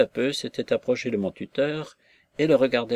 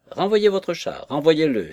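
higher, louder angry outcry; angry cry; 6 semitones higher, 5 dB louder than usual. A narrator's reading. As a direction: shoo the cat out in a temper. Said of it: "s'écria la petite vieille avec colère".

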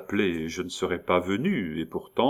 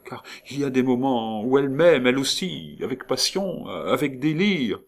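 high, fervent outpouring; impassioned; 7 semitones higher, 4 dB louder than usual. A narrator's reading. Count insisting his wife loves him too, impassioned. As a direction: let passion lift the voice, drawing out the end.

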